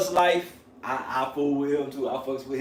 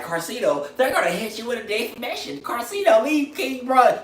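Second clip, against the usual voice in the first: mock commenters; 8 semitones higher than usual. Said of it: "People be in the comment section like".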